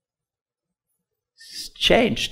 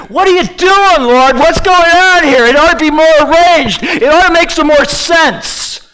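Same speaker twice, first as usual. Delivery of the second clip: loud, high-pitched pleading at God; acted outburst of bewildered protest, shouted high; exasperated complaint; 11 semitones higher, 7 dB louder than usual. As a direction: wail your frustration up to the Lord. Acts out people complaining to God.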